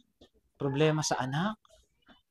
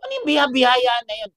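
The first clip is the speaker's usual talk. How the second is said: louder, higher challenge; acting out a stubborn kid; defiant child; 5 semitones higher, 7 dB louder than usual. Child brushing off the teacher's talk of grace, defiantly. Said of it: "sabi ng bata".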